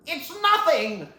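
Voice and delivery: high, drawn-out villain cry; hammed-up dismay; acted outraged disbelief. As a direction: exclaim slowly in shock. Villain finds scroll blank.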